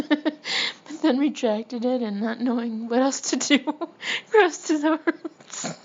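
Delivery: trying to narrate through real laughter; laughing while reading. Real, not acted. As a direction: read on while cracking up. Cracks up reading own story.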